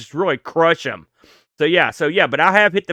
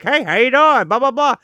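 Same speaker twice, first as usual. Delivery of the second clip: a lightly raised, silly hand-puppet voice; sock puppet voice; 8 semitones higher than usual. Retold or imitated. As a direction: talk like a cheap sock puppet saying hi. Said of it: "the kind you stick your hand up and go".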